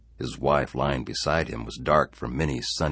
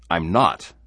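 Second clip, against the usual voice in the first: angry denial; 4 semitones higher, 9 dB louder than usual. Acted. Harry denies he is changing.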